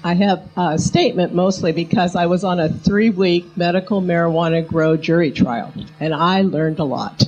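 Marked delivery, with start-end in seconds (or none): none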